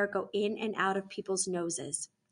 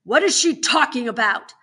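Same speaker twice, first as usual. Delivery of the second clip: loud exasperated demand; angry warden's outburst; roaring; 3 semitones higher, 11 dB louder than usual. A narrator's reading. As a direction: roar the question at them. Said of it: "the warden roars".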